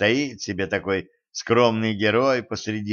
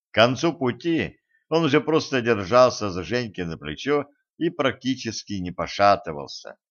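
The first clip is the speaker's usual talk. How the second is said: usual speaking voice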